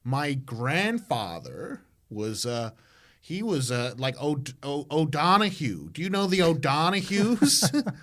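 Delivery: playing the eager American tourist chasing roots; mock American accent. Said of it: "an American walking around going".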